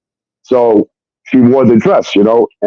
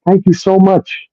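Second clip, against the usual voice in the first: mock grateful thanks; 7 semitones higher, slower than usual. Mimicking the girl thanking him after the owner threw the guys out, high and slow. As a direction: ham up her sweet gratitude. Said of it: "she sat there like this".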